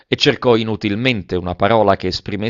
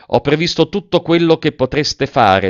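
higher, louder, clinging desperately; desperate tenacity; 7 semitones higher, 5 dB louder than usual. A narrator's reading. Lover to Velleda, desperate insistence. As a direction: insist as if nothing could stop you. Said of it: "diss'egli con tenacità disperata".

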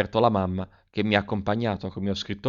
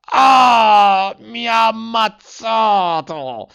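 very high, loud wounded scream, the last words slow; screaming cry; 12 semitones higher, 7 dB louder, much slower than usual. A narrator's reading. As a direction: howl as if just shot. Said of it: "si udì un urlo".

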